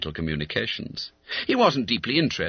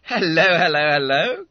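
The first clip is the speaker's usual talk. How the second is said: loud, higher, surprised hello; cried out; 8 semitones higher, 7 dB louder than usual. Acted. Schoolmate spotting something in the letter, crying out. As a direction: hail the discovery loudly. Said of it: "cried Bob".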